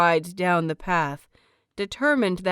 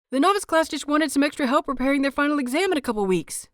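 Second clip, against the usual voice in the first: fast, higher breathless blurt; in a rush; 7 semitones higher, much faster than usual. A narrator's reading. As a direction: get it all out before you lose your nerve. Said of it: "gets out in a rush".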